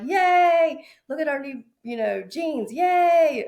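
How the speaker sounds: high, loud squealing cheer; excited. Retold or imitated. Carly cheering about the new jeans, loud excited squeal. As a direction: mimic a giddy friend shouting yay. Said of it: "She says she was so excited".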